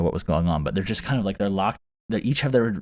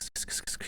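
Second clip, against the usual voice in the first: unvoiced sk sk sk skittering; whispered skitter sound; 17 dB quieter than usual. Lady iguana skittering up the hill, whispered clicks. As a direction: hiss a tiny skitter noise. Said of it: "She's like".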